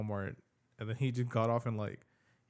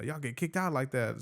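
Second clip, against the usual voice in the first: ordinary talk, nothing marked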